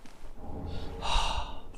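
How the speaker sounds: unvoiced-sounding long breath out; sigh. Real, not acted. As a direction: let out a soft sigh. Reacts during game.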